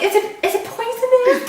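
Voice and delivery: very high giggling fright; laughing mock fear. Wife asking her prankster husband, high giggling fear. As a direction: stammer it out scared and laughing.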